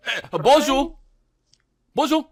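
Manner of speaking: high, loud French telephone greeting; French phone answerer. Acted. Frenchman picking up the castle phone, hammed-up loud hello. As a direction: call into the phone as an over-the-top Frenchman.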